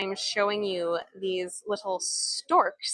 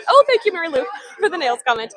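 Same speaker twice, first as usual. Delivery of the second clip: laughing; 6 semitones higher, 6 dB louder, faster than usual. Real, not acted. Laughing at viewer's comment.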